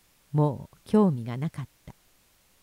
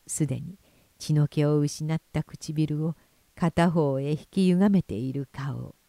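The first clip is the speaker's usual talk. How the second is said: plain reading voice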